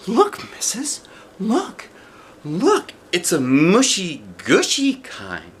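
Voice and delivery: high, gushing and slow; excited child. Acted. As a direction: gush over the card. Junie B excited over card.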